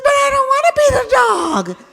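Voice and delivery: put-on a bossy child starting to cry; crying little girl. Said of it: "She says".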